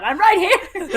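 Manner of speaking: high, loud cry for attention; exasperated high yell; indignant shout. Acted. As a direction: shout up at people who overlook you. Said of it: "Nyla jumps up and down".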